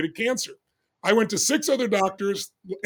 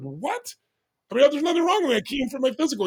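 a sharp high jump of retold disbelief; high, startled protest at the doctor; stunned disbelief; 7 semitones higher than usual. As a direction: relive the shock of the news, pitching up. Told of a brain tumor.

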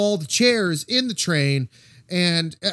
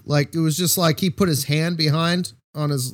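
ordinary talk, nothing marked

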